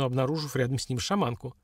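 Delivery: usual speaking voice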